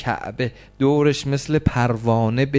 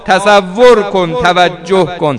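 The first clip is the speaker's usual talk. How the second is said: loud, high, keening chant of grief; mourning preacher crying out to God in prayer; wailing lament; 10 semitones higher, 14 dB louder than usual. Acted. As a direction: cry it out over the crowd at full force. Full-force wailing lament, prayer.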